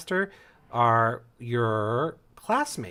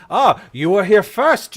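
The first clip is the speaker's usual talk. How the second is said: high, loud mock surprise; scornful, raised-voice echo; indignant battle master; 7 semitones higher, 11 dB louder than usual. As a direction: throw their claim back at them, loudly. Battle master mocks the students' claim.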